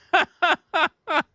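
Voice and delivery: high-pitched burst of laughter. Real, not acted. Amused by the letter string.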